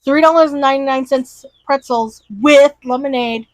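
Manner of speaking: normal talking voice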